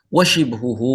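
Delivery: ordinary talk, nothing marked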